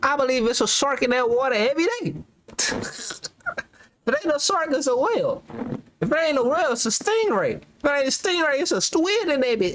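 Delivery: excited rant. Real, not acted. Rhythmic shark, whale, stingray rant.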